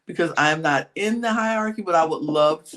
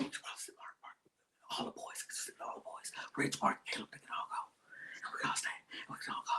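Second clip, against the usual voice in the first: whispering; 21 dB quieter, faster than usual. Retold or imitated. Retelling whispered plotting.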